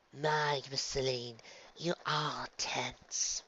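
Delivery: soft, very slow, soothing murmur; calm her with drawn-out words. A narrator's reading. Ella massaging tense Luna, soothing. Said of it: "in a soothing voice".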